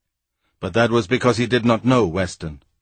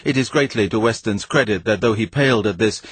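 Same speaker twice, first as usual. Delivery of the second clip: ordinary delivery, nothing marked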